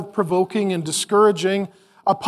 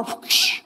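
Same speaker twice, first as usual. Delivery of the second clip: unvoiced swoosh noise; a sudden breathy whoosh; 5 dB quieter than usual. Preacher voicing the mom's sudden swipe, a whoosh. Ninja mom strikes again.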